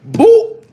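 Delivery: high, loud boop; sound effect boop. Retold or imitated. Mimicking the deleted post, a high loud boop.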